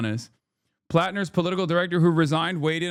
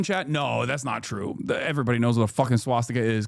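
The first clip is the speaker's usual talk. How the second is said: ordinary delivery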